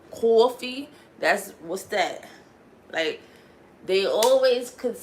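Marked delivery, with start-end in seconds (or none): 0.1-0.9 louder New York coffee, pitched up; New York accent. Retold or imitated. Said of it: "when they be like".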